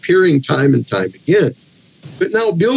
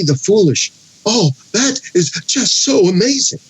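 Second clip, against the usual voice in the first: higher-pitched, exaggerated false awe; brutal sarcasm; 5 semitones higher than usual. Job, the weak and foolish, thanking his friend, heavily sarcastic. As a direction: gush with mock amazement at the great wisdom. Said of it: "it's utter brutal sarcasm".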